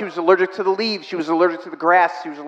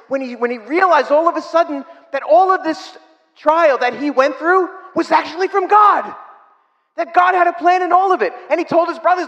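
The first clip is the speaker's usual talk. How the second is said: preaching climax; 8 semitones higher than usual. Acted.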